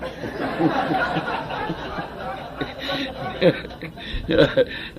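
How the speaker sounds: long wheezy laugh; real helpless laughter. Real, not acted. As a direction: crack up at your own story. Laughs at his own impression.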